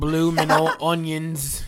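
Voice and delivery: loud, high, hammed-up Australian accent; bad Australian accent. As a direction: belt out a fake Australian twang. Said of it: "he wants you to do that accent".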